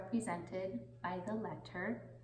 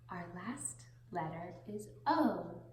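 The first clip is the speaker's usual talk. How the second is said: normal talking voice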